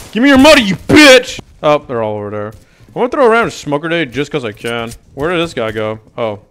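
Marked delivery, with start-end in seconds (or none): none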